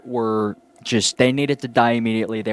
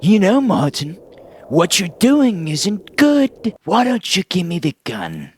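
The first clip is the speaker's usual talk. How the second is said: high, slow, wheedling creepy doctor; doctor's creepy voice; 8 semitones higher, slower than usual. Retold or imitated. Doctor coaxing Martin to hand over the gun, in a high, slow voice. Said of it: "he's like".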